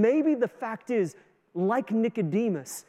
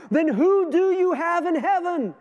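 higher, louder desperate cry; 8 semitones higher, 6 dB louder than usual. Retold or imitated. Whitefield cries to Saint Peter, desperate and loud. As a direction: cry out in desperation at heaven's gate. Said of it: "Whitefield cried out".

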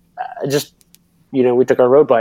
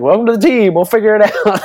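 much higher, mock welcoming voice; imitating Al; 10 semitones higher, 4 dB louder than usual. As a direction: play the coach welcoming him, laughing. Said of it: "He was like".

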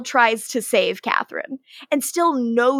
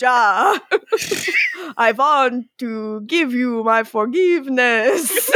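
slow, hammed-up Dracula accent, breaking into laughs. Mock Gregor forgiving Nadja, in a Dracula voice. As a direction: do a silly Dracula accent, drawn out. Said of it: "done a Dracula voice".

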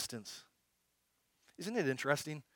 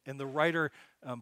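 ordinary talk, nothing marked

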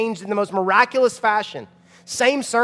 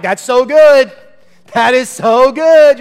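loud, high congregant raving; imitated enthusiasm; 6 semitones higher, 12 dB louder, slower than usual. Churchgoers reacting to the sermon, mock-raving. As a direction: shout it like an amazed listener, stretching the repeat.

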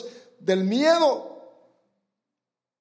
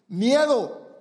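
ordinary talk

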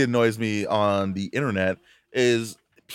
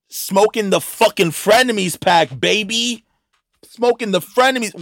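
gloating; 8 semitones higher than usual. Real, not acted. Gloating over podcast ending.

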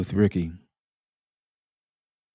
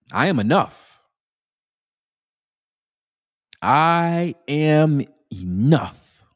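higher and stretched out, with energy; energetic affirmation; 6 semitones higher, slower than usual. Shown as a demonstration. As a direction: put some energy into the affirmation. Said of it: "use a little energy now".

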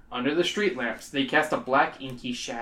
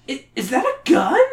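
put-on scared girl; 10 semitones higher, 4 dB louder than usual. Girl frightened by gun.

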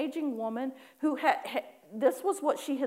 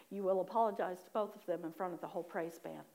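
low, soft imitated order; firm quiet command; 5 semitones lower, 7 dB quieter, faster than usual. Holy Spirit ordering her to apologize, low and firm. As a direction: lay down the law quietly.

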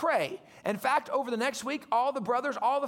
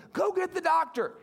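raised higher, a mock yell for help; retold yell; 6 semitones higher than usual. Mother yells at husband to fetch doctor, mock yell. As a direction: call out for the doctor, half-acted. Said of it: "She yells at her husband".

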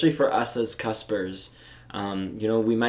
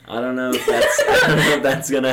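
laughing through the words; can't finish for laughing; 3 semitones higher, 7 dB louder than usual. Real, not acted.

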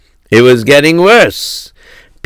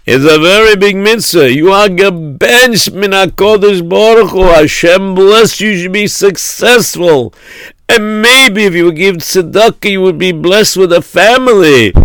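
put-on high, insistent old-world rabbi; pleading rabbi; 8 semitones higher than usual. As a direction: urge him on in a raised, chanting rabbi's voice. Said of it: "came over to him and he said".

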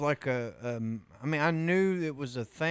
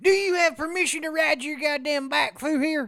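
put-on cranky old man; 9 semitones higher than usual. Acting cranky old neighbor.

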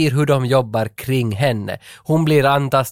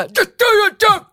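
high, rushed mock yell; exaggerated shout; 4 dB louder, much faster than usual. Replaying the manager's line for the co-host, exaggerated shout. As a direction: fire it off as a hollered order. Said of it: "Han skriker ju inte alls".

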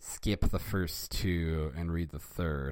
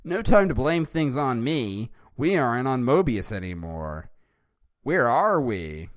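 high, loud cartoon hero voice; raised, theatrical exclaiming; Sonic; 6 semitones higher, 8 dB louder than usual. A narrator's reading. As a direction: call out urgently as a hammed-up hero. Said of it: "And Sonic said".

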